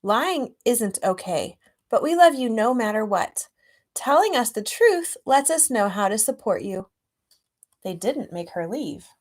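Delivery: storybook reading; story narration. A narrator's reading. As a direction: read it like a picture book to a class. Reads picture book aloud.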